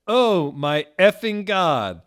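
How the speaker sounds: very loud, high scream. A narrator's reading. Hedwig screaming Voldemort's name, loud scream. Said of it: "screamed Hedwig".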